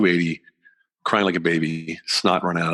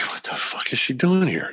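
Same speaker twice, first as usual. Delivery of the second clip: higher, retold baffled outburst; stunned disbelief; 6 semitones higher than usual. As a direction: recall the shock of seeing her there. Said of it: "And I'm like".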